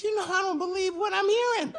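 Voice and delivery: shocked pastor. Retold or imitated. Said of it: "he's sitting up here like".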